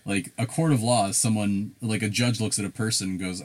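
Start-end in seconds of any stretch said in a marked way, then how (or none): none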